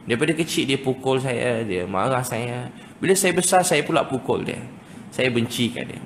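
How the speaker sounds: ordinary delivery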